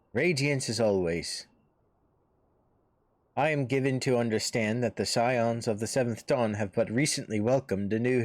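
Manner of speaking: lightly voiced formal military envoy; a hint of a gallant officer, measured delivery; courtly officer. Maelstrom officer greets the lady and offers the hero a place, lightly voiced courtly officer.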